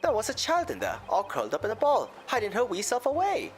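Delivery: a lilting Irish brogue; Irish accent. Acted. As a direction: tell it like an Irish witness. McGilded testimony in accent.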